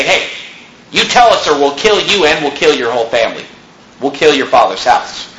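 normal talking voice

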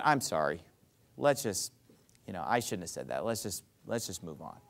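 low, soft, backing down; conciliatory apology. Acted. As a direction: drop your voice and let the argument go. Models a peacemaking apology.

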